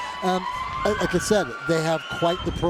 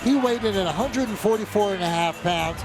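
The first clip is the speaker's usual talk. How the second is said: higher, excited arena intro; hype walkout intro; 5 semitones higher than usual. Real, not acted. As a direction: pump up the crowd. Commentator introduces fighter walkout.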